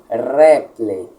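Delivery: "reple" opening on a long trilled r; rolled R. Shown as a demonstration. Teacher showing students the rolled r, "reple" with a long trill. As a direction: trill the r of "reple" like several r's. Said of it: "Fale dessa maneira".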